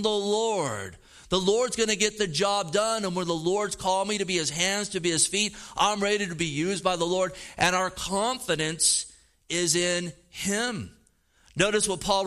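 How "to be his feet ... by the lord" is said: usual speaking voice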